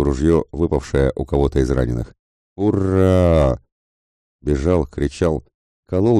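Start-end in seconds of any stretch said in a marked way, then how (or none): 2.6-3.6 high, drawn-out cheer; battle cry hurrah. Acted. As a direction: yell it as you charge. Soldiers shout during the charge. (протяжное «ура» в атаке)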